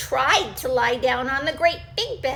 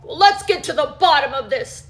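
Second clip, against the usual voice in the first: growled; 9 dB louder, faster than usual. Acted. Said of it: "Daddy Bear growled".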